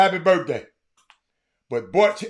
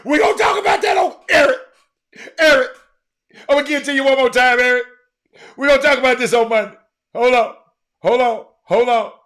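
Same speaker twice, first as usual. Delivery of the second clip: loud, higher-pitched insistent call; shouting over playback; 4 semitones higher, 5 dB louder than usual. Real, not acted. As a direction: cut in loudly to stop everyone. Shouts over the replayed clip to Eric.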